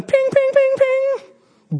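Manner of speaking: high, sharp pinging like chains snapping; ping out each broken shackle, high. Retold or imitated. Pastor imitating the prisoners' chains snapping, high pings.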